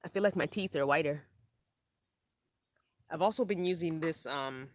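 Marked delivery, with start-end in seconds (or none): none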